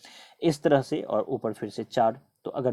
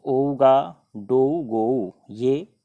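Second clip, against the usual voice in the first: slow emphasis; 6 dB louder, much slower than usual. Shown as a demonstration. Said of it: "दिमाग थोड़ा सा ज़्यादा concentrate करना पड़ जाता है".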